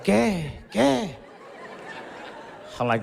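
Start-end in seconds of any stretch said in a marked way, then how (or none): 0.0-1.1 grandma. Retold or imitated. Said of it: "She's like".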